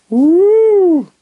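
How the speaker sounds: high, loud whoop of a sign-off; excited whoop. Real, not acted. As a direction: let out a big excited woo. Sign-off cheer after his name.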